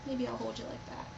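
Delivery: ordinary talk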